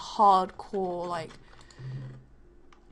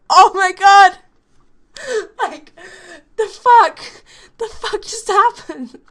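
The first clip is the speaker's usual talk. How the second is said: high, loud, laughing shock; watching the teacher pin both girls, stunned; hysterical disbelief; 10 semitones higher, 15 dB louder, slower than usual. Retold or imitated. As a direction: shriek it half laughing. Karate teacher tackles both girls.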